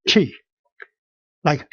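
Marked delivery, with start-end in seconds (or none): 0.1-0.3 "chi" said like "chee"; isolated "chi", clearly sounded; demonstrated sound. Shown as a demonstration. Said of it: "pronounced as C H I".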